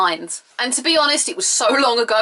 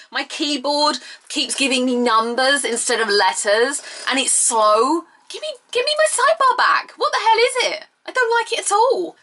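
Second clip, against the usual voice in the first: whiny complaint; 6 semitones higher than usual. Real, not acted. Ranting about phone update.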